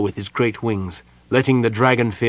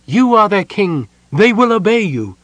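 loud, much higher pleading orders; urgent command; 9 semitones higher, 5 dB louder than usual. Acted. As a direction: rouse him to act, urgently. Mina urging king to command.

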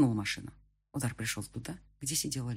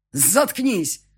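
loud, higher angry snap; barked command; 6 semitones higher, 8 dB louder than usual. A narrator's reading. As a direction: bark it to cut him off. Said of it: "рявкнул я". (рявкнуть зло)

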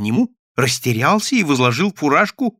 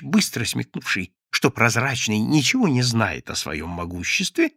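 plain reading voice

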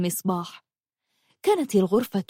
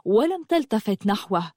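ordinary delivery, nothing marked

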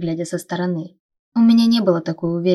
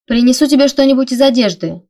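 raised, higher voice calling through a noise; calling over water; 6 semitones higher, 8 dB louder than usual. A narrator's reading. Erika calls to her sister in the shower, loud and high. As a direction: call out over running water. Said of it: "услышала я голос Эрики сквозь шум падающей воды".

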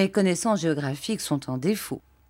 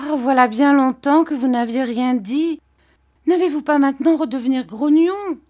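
put-on higher, louder teasing young wife; Dora's voice; 8 semitones higher, 6 dB louder than usual. Young wife Dora teasing her serious husband, higher and louder. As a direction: pout it out playfully to her husband.

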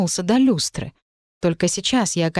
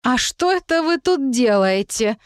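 belligerent; 5 semitones higher than usual. Acted. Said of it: "воинственно спросила".

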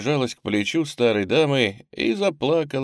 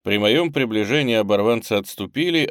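ordinary delivery

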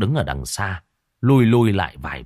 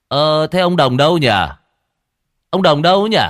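louder, higher teasing call; 6 semitones higher, 6 dB louder than usual. Acted. Exorcist calling out loudly for hiding old Đồng. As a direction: call out loud, pretending to search.

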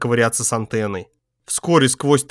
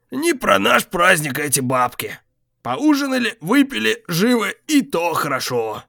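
weary tough guy; 7 semitones higher than usual. A narrator's reading. Vityok calls bedtime.

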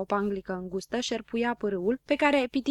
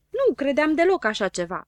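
higher, louder indignant retort; annoyed; 6 semitones higher, 6 dB louder than usual. A narrator's reading. Habar n-am defends himself to Don Dănel, annoyed. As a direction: snap back, offended. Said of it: "se supără Habar n-am".

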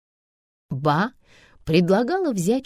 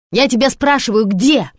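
sharp raised demand; 6 semitones higher, 8 dB louder than usual. A narrator's reading. Mother pressing Karinka for an answer, raised demand. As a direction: press her hard for an answer.

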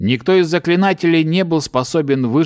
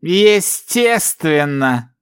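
slow, higher scoffing answer; smug scoff; 4 semitones higher, much slower than usual. A narrator's reading. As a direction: toss it off with contempt. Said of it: "хмыкнул элементалист".

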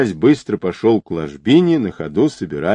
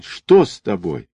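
usual speaking voice

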